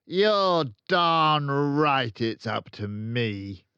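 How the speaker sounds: loud, slow bellow. A narrator's reading. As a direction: bellow each word. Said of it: "Miss Trunchbull bellowed".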